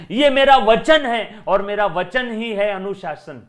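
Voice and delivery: epic film vow. Acted. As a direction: thunder it like a Bahubali dialogue. Katappa's promise in film dialogue. (Baahubali film की तरह ज़ोरदार, धीमा संवाद)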